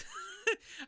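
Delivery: short high burst of laughter; real laugh. Real, not acted. Telling viewers about getting fired, real laugh. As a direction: laugh at the absurd punchline.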